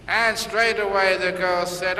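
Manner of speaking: normal talking voice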